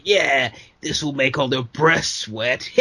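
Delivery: slow put-on wrestler voice; mock wrestler ad. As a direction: drawl it out as a cheesy ad pitch. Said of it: "like, the X being like".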